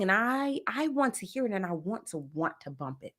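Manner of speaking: usual speaking voice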